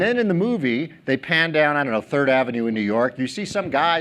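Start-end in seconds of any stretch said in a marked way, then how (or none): none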